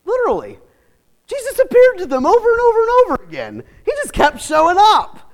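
loud, high joyful proclamation; excited preaching. Acted. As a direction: celebrate it. Preaching at full force.

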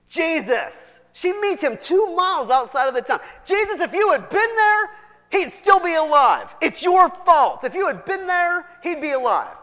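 high, loud accusing cry; accusing Martha. Acted. Martha blaming Jesus outside the town, loud accusation. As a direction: blame him for the death, shrill with grief.

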